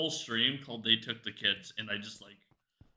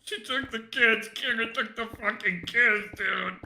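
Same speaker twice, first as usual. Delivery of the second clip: hammed-up heartbroken wail; fake crying; 10 semitones higher, 3 dB louder than usual. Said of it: "me pretending to cry".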